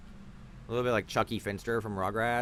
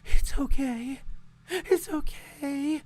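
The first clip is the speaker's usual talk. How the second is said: high, slow, cooing fake mother; mocking mom voice; 5 dB louder, much slower than usual. Streamer mocking the mom comforting a crying baby, high and cooing. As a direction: parody a bad actress soothing a baby. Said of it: "and the mom is like".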